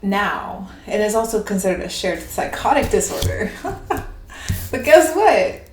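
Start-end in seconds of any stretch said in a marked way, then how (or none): 3.5-4.6 high airy giggle. Real, not acted. Laughing before punchline.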